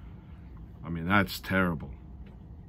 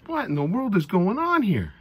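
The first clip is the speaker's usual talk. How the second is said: amused disbelief; 11 semitones higher than usual. Retold or imitated. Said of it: "I'm laughing, I'm chuckling, I'm going".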